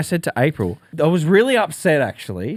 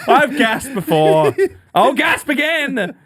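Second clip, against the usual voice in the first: mock defiance; 10 semitones higher, 4 dB louder, slower than usual. Mock-defiant retort about gasping.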